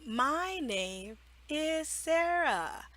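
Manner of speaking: a hint of a child repeating, slow and soft; student repeating. Child repeating after the teacher, slow. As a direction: repeat it carefully like a learner. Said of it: "they will repeat".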